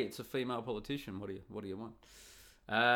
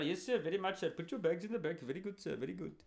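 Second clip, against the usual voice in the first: mocking Indian accent, pitched up; Indian accent impression; 7 semitones higher, faster than usual. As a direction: put on an Indian taxi driver's accent, overly polite. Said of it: "you're getting an Indian".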